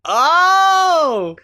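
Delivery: high, loud delighted Oh; delighted cry. Real, not acted. Reacting to his friend's line with a high loud Oh. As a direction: exclaim with delight. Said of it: "Hope he's listening".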